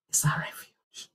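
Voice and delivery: hushed whisper of the promise. Acted. Preacher whispering to the congregation, hushed. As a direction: breathe it out as a secret.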